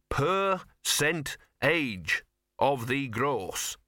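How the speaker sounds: each syllable spelled out slowly; slow careful teaching. Shown as a demonstration. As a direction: dictate it slowly for a learner. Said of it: "Think you can remember it?".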